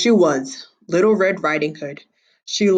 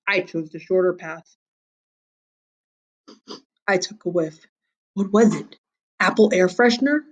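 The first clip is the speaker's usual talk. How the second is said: plain reading voice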